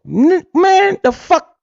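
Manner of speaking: mock outraged disbelief. Reacting to imagined zero output.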